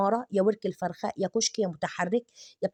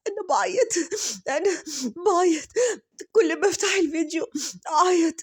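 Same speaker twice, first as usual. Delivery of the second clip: hammed-up sobbing, much higher; mock crying; 8 semitones higher, 4 dB louder than usual. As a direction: fake a sob on every word. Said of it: "قالت لكم". (تقليد بكاء مبالغ فيه بصوت أعلى بكثير)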